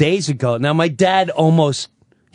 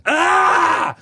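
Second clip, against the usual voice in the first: sudden much higher yelp of pain; father's scream. Retold or imitated. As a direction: yell out as if hurt. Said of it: "she hears".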